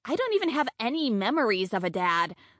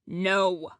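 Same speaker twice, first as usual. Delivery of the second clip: weighty, rumbling refusal; low dramatic rumble; 3 semitones lower than usual. A narrator's reading. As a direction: rumble it out with mock gravity. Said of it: "it comes out in a low, dramatic rumble".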